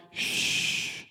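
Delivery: a long soft shh to still the storm; Jesus whispering the storm quiet; hushing whisper. Acted. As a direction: breathe it out, no voice at all.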